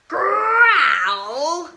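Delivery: loud growling delivery; growled word. A narrator's reading.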